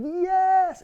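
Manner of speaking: high loud cheer; excited cheer. Retold or imitated. Relatives gathered round cheering, high loud yes. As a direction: cheer like the whole family. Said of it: "they were just like".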